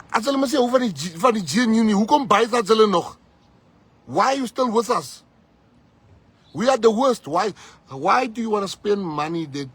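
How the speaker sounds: high, loud fiery appeal; impassioned shout. Real, not acted. Rallying the crowd against the DA, impassioned shouting. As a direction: preach it at full force.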